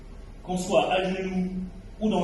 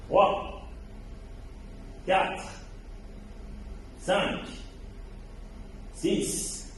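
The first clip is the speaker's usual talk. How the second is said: strained counting between reps; doing push ups; 4 semitones higher, 3 dB quieter, much slower than usual. Real, not acted. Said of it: "je vais faire la première sur les genoux".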